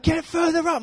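high-pitched mock yell; touchline dad shout. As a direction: imitate a dad urging his kid forward. Dad yelling at son's match.

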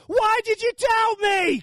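high, slow shout over a storm. Shown as a demonstration. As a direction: bellow it over howling wind. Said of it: "would have had to shout".

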